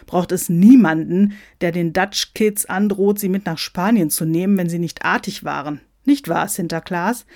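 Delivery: ordinary delivery, nothing marked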